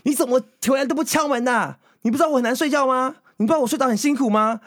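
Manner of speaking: high, mock-furious scolding of a roommate who got woken up; imitating an angry scolding. Retelling how the boyfriend scolded her for not knocking, in a high, imitated angry voice. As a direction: play the grumpy boyfriend snapping at the late arrival, pitched high. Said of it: "他就大骂说".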